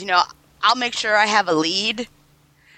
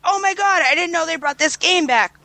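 excited friend; 8 semitones higher than usual. Retold or imitated. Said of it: "He's like".

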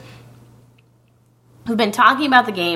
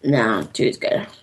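moaning while chewing; 5 semitones lower than usual. Real, not acted. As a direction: mouth full, moaning with pleasure. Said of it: "Okay, stop moaning".

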